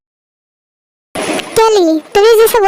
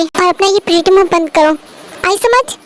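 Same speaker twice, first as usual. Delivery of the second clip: ordinary delivery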